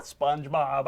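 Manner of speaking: mild acted irritation; getting angry. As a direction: try to cut in, a touch annoyed. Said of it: "now Squidward's getting angry".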